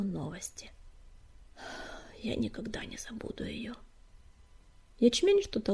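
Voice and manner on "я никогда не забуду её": very soft, sorrowful vow; hushed, grieving and breath-led; sad sigh. A narrator's reading. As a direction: sigh it out almost in a whisper. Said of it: "печально вздохнул Горелый".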